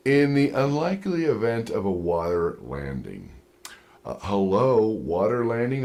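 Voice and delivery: low, smooth safety-video voice; calm crafted cabin announcement; flight safety announcement. Retold or imitated. Safety video to passengers, low and soothing. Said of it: "it's crafted".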